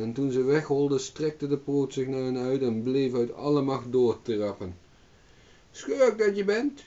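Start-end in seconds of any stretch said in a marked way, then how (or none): 5.7-6.8 high, shrill yell of rage; shrieking. A narrator's reading. Old hag shrieking at the farmer in rage. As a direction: screech at him furiously. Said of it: "schreeuwde de schraapster".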